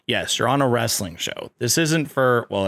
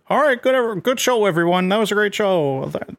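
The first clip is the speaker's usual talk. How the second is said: high, bright Muppet frog voice, much higher than his own; a cheery backstage Kermit thanking the cast; 9 semitones higher than usual. Retold or imitated. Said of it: "He's like".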